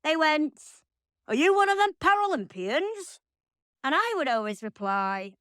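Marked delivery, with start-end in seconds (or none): 1.3-3.2 a hint of Cockney, pitched higher; lightly voiced Cockney cabbie; bad Cockney accent. Taxi driver asks [name], in a hint of Cockney. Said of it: "I can't do a Cockney accent".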